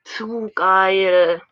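loud gushing delight; loud, thrilled cheer. Real, not acted. Gushing over skin.

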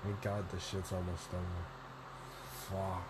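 ordinary talk

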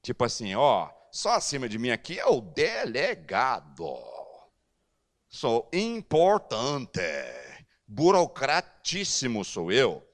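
slow, self-important official; slow mock pompous clerk; puffed-up pride. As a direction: mouth full of his own importance. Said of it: "Ele encheu a boca".

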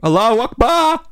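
high, slow shouted cry; mock cry. Joke exclamation.

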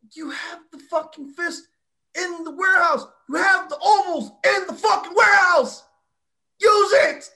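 high, loud frustrated rant; exasperated yelling. Retold or imitated. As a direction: yell at the show bookers. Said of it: "I'm like".

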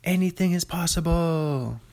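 high, drawn-out triumphant shout; Kevin Garnett yell. Retold or imitated. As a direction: belt it like a champion celebrating. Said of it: "Kevin Garnett yelled out".

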